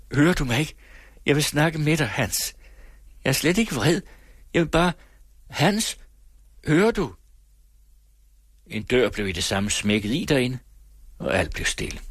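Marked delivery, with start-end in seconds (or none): 0.1-7.1 higher, rising wheedling calls; pitched-up coaxing pleas at the locked gate. Acted. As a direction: sweet-talk him, more and more desperate. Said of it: "hviskende, indsmigrende stemme".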